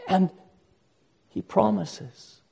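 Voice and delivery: high, loud, fervent pulpit preaching; preaching at full force. Acted. Pastor urging his congregation to come to the shepherd, preaching at full force. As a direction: drive the call home to the whole congregation.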